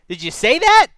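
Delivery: loud, high-pitched disbelief; real exasperated challenge; incredulous outburst. Real, not acted. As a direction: blurt it out, voice jumping way up. Host incredulous at withheld allergy.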